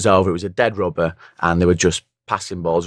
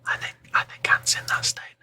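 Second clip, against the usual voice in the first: whisper; 8 dB quieter than usual. Real, not acted. Hungover guests whispering aside.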